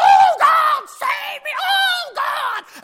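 very high, loud imitation wail; mock screaming plea. Preacher imitating people begging God at the altar, screaming. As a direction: wail to heaven in a hammed-up scream.

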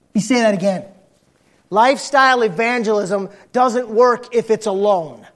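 ordinary talk, nothing marked

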